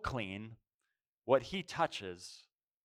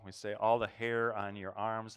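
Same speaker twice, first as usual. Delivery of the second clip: usual speaking voice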